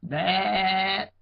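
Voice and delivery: a sheep answering back. A narrator's reading. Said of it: "answered the sheep".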